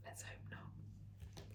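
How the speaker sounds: slow, soft whisper. Real, not acted. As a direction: breathe it out as a slow aside. Whispered closing aside.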